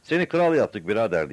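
crowd shouting up. A narrator's reading. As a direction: shout the news up to him. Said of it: "diye bağırdılar".

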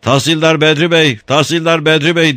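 high, loud shouted call; shouting. A narrator's reading. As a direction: yell his name up the stairs. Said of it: "diye bağırdılar".

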